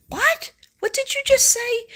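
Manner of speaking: high, loud startled what; shocked disbelief. Retold or imitated. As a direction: jump up in pitch, stunned. Shocked by spirit's news.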